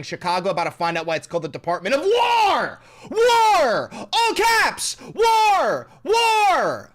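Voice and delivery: builds to a screaming high WAR; rising shout. Acted. Reading post in rising rage.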